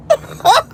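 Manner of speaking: high burst of real laughter. Real, not acted. Telling viewers she knows she's fat, bursting out laughing. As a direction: crack up at your own joke.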